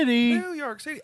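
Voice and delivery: very high, cartoonish squeak; high squeaky voice. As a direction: squeal the words playfully. Repeating New York City squeakily.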